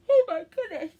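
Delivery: loud, high words through a yawn. Real, not acted. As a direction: apologise while caught in a real yawn. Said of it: "I keep yawning".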